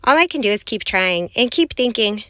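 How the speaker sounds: ordinary delivery, nothing marked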